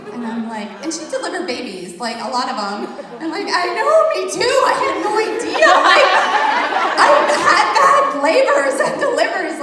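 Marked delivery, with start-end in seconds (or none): 3.5-9.6 high, loud, laughing outburst; excited disbelief. Retold or imitated. Telling of her reply to the nurse, excited disbelief. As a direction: blurt it out, voice leaping up with laughter.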